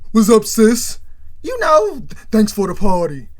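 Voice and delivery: loud, falsely casual greeting; everything's sweet. Retold or imitated. Creep brother greeting his sister, falsely sweet. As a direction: greet her like nothing happened. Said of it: "like everything's sweet".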